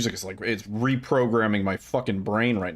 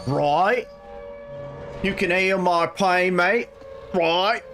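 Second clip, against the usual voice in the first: put-on rough Australian accent, higher and drawn out; hammed-up Aussie bloke impression, higher pitch; 9 semitones higher, slower than usual. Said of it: "My, my Australian is not very good".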